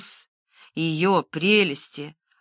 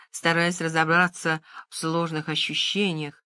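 ordinary delivery

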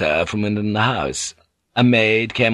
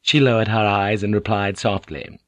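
ordinary delivery, nothing marked

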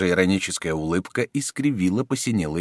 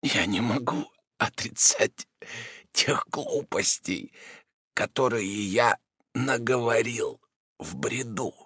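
slow, softer feeble voice; slowly, softer, barely holding on; weak sick man; 4 semitones higher, 5 dB quieter, slower than usual. A narrator's reading. Rodin voice.

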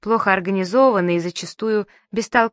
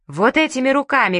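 raised furious cry; shouting; 6 semitones higher, 4 dB louder than usual. A narrator's reading. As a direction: yell it at her son. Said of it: "кричала Ба".

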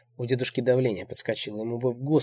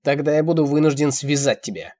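loud, raised warning; threatening; 4 semitones higher, 9 dB louder than usual. A narrator's reading. Old man threatens to restrain her, menacing. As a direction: announce the threat forcefully.

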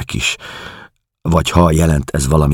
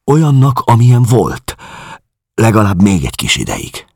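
inconsolable; 7 semitones higher than usual. A narrator's reading. Schwarz pleading that her memory survive a while, inconsolable. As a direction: let the despair push the voice up and out. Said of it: "mondta hirtelen vigasztalanul".

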